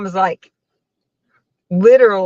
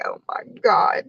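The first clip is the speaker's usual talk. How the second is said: whispered under the breath in embarrassment; embarrassed whisper; 6 dB quieter than usual. Retold or imitated. Daughter mortified at mom's text, whispering.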